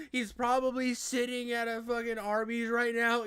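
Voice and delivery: usual speaking voice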